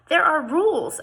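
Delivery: high, loud exasperated insistence. A narrator's reading. Jane snaps at Mister Swan, exasperated and loud. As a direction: throw up your hands at him. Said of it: "I said, exasperated".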